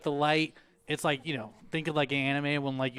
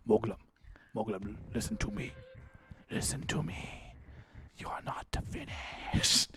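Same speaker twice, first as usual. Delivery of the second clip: creepy pumpkin; 6 semitones lower, 8 dB quieter than usual. Acted. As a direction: whisper a summons as an evil pumpkin. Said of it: "it has this voice".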